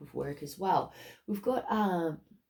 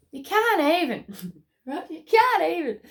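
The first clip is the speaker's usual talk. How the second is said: giddy excitement; 10 semitones higher, 7 dB louder than usual. Real, not acted. Overwhelmed with delight.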